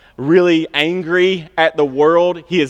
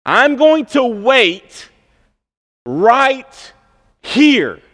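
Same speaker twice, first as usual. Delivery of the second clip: slow, raised, deliberate vow with long pauses; Habakkuk's vow; 6 semitones higher, slower than usual. Acted. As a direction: plant your feet, spacing out each word. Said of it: "And Habakkuk is saying".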